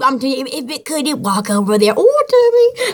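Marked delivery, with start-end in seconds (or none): 1.9-2.9 lightly raised pitch, a little louder, laughing through it; a light, playful put-on oyster voice. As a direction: play a cute oyster calling you over. Oyster with feet comes over.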